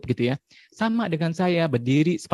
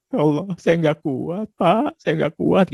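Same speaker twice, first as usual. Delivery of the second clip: slow, pleading complaint; 2 semitones higher, slower than usual. Retold or imitated. A worn-out client pleads with the ustadz, slow and pleading. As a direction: beg slowly that you can't cope.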